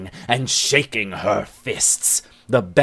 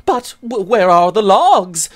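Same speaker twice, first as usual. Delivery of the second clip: high, louder, flustered voice of the shy friend; hurried parry; 9 semitones higher, 8 dB louder than usual. Acted. Frightened Bennet dodging the challenge: high hurried voice. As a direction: stammer it out, flustered. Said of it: "she hurriedly parries".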